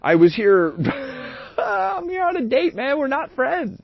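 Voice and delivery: slow, then high and laughing; laughing retold exit. Telling the date he's leaving, breaking into high laughter. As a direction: crack up mid-line telling her off. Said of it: "and I'm like".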